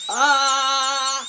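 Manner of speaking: short loud cry of pain. A narrator's reading. Boy in the chair crying out in pain. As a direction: yelp as the drill bites. Drill hurts.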